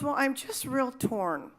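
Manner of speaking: slow, hesitant admission; torn and hesitant. Retold or imitated. Woman at the door tells the canvasser she's torn, slow and hesitant. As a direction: drag it out like she can't decide. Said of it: "And she goes".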